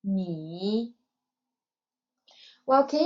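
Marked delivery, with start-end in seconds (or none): 0.0-0.9 你 dipping low, then rising; third tone. Shown as a demonstration. Teacher models 你 for learners, in third tone. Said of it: "with a third tone".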